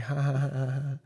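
mock texting laugh, evenly spaced has; imitated giggling. Friend texting a girl, mocked even laugh. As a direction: fake a silly laugh to oneself. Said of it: "I was texting this bitch, like".

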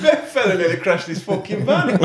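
usual speaking voice

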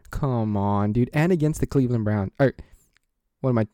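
normal talking voice

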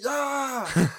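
high-pitched retold scream; mock yell. She yells into the next stall, high mock scream. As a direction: let out a high, drawn-out play yell. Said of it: "yells at it".

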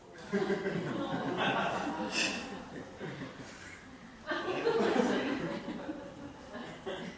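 long, rolling laugh. Real, not acted. Pastor showing a meme, long laugh. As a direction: keep laughing at the picture.

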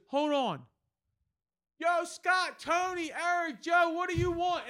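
very high mock holler; high, stretched-out calls across a bar; drunk calling out. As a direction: imitate a drunk hollering to his friends. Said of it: "He's fucking shit-faced".

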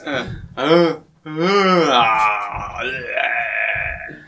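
groaning zombie impression, cries trailing off. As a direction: moan and gasp like the undead.

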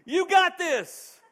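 high, loud cheering holler; mock doctor hollering down the birth canal. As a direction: yell encouragement like a pumped-up coach. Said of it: "a doctor hollering down the birth canal".

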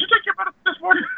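high, fast, laughing through it; can barely hold back laughter. Real, not acted. Teasing cohost, laughing mid-question.

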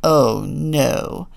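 low, dismayed pig groan; low, gruff pig voice; grunting. A narrator's reading. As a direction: grunt it with dread. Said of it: "grunted Walter".

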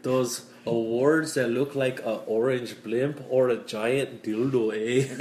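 hammed-up Canadian with a tagged "eh"; Canadian accent. Canadian describing favourite awards, mock Canadian accent. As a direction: put on a broad mock Canadian. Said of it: "We here in Canada".

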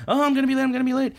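high, loud squeal; panicked anime girl. Retold or imitated. Karen running late.

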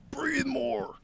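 high, soft, sing-song advice; mock coach. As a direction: toss off the useless tip again. Voice repeating useless advice.

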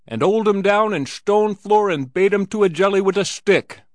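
high, loud, frenzied Irish brogue; sustained brogue wail at full pitch. A narrator's reading. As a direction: rage away in a thick Irish brogue. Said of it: "burst into sudden brogue".

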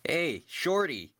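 calling out. Acted. Player calls out to captain.

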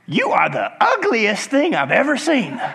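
high squawk leading into parrot speech; talking parrot, pitched high. Acted. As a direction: insult someone in a squawky parrot voice. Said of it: "And the parrot says".

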